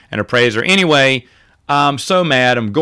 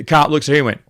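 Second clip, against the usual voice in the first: ordinary talk, nothing marked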